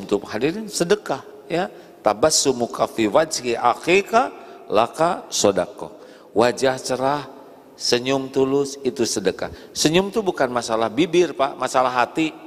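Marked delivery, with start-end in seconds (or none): none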